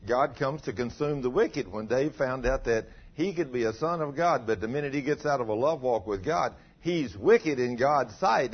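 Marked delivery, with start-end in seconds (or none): none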